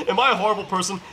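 ordinary delivery

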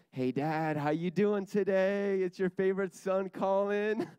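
high, loud, drawn-out singsong hello; exaggerated cheery greeting. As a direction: play up a chirpy call to Dad, stretching the words. Acted phone call to his dad.

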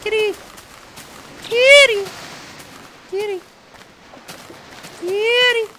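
very high, loud squealing calls of kitty. Real, not acted. Calling to the in-game kitty, high squeals. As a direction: shriek with delight for the cat.

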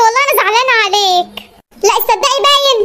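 ordinary delivery

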